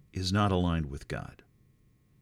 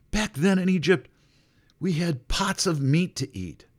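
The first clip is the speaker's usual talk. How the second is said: whiny complainer; 9 semitones higher, 4 dB louder than usual. Retold or imitated. Mimics complaining Israelites.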